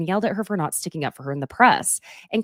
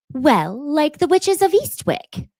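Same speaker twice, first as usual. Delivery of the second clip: quoting Dorit; 7 semitones higher, 3 dB louder, slower than usual. Retold or imitated. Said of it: "and Dorit says".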